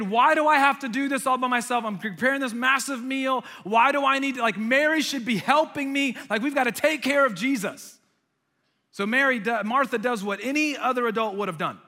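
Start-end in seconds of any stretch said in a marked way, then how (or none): 0.0-8.0 loud, high exasperated rant; frustrated. Acted. Martha venting about cooking alone, frustrated. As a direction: complain in mounting exasperation, pitched up. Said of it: "get frustrated".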